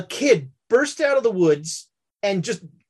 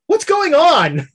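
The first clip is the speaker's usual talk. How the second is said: imitating Jared; 10 semitones higher, 6 dB louder, slower than usual. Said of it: "you hear Jared go".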